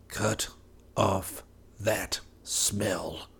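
slow, breathy unvoiced whisper. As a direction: hush it out slowly to her. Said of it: "he whispered to her".

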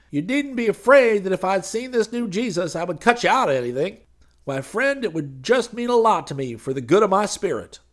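high, loud pleading character voice; wheedling preacher. A narrator's reading. Preacher coaxing Hayes to show him the new Jesus, wheedling. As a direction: plead big and high, as a friend.

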